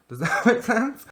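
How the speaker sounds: high laughing question. Real, not acted. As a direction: giggle through the words.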